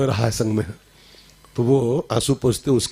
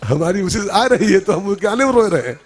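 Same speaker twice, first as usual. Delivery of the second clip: higher, laughing through the words; mock the fool while cracking up; laughing punchline; 5 semitones higher than usual. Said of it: "punchline of the joke". (हँसते हुए मज़ाक उड़ाना)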